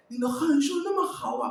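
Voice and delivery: high, playful mock praise; impressed native speaker. Chinese listener reacting to a mere ni hao, mock impressed. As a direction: pitch it up in mock amazement. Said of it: "Il dit".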